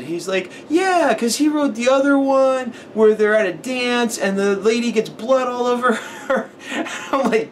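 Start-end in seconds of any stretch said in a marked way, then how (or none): 0.7-6.5 put-on high, slow, earnest little kid. Retelling how the kid described the movie, in a high slow child voice. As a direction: talk like a small boy explaining a movie.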